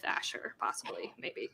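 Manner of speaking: shy, soft whispered admission. Real, not acted. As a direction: whisper it like a secret. Shy confession about dancing with Asher.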